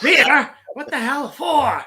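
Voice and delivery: loud, high disbelieving yell; incredulous shout. Retold or imitated. Drunk villain shouting at Bond for wanting a beer, loud. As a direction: bark it high and astonished.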